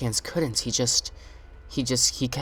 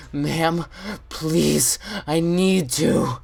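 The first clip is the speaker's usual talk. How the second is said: higher, slow begging; desperate pleading; 4 semitones higher, much slower than usual. Retold or imitated. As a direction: plead like you can't hold it.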